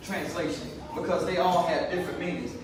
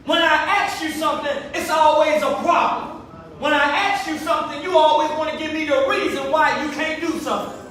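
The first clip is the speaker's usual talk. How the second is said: stern rebuke; 5 semitones higher, 4 dB louder than usual. Acted. Preacher voicing Jesus' rebuke.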